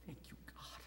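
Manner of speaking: 2 breathless whispered thanks; 3 whisper it to God alone; 4 hushed gratitude; 1 whispered prayer. Acted.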